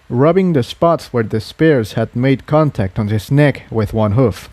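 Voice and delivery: ordinary delivery, nothing marked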